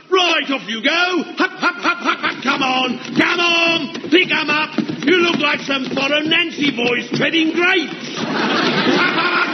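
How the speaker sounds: high shouted hup hup to keep pace; shouted drill cadence. Acted. Sergeant driving the running drill.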